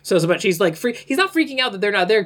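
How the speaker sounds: ordinary talk, nothing marked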